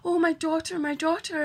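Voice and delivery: high, loud mock wailing over a daughter; mimicking mum putting on a show of worry; fake worried mother. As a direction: ham up a mother's false concern. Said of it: "acting all like she's fucking worried".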